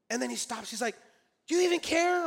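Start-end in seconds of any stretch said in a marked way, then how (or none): 1.5-2.3 higher, louder mock-exasperated question; agitated challenge. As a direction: imitate a coworker demanding a reaction. Agitated coworker confronts him, retold.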